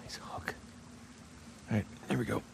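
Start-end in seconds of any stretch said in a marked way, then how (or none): 0.0-0.5 whisper. Real, not acted. Whispered aside after partner's joke.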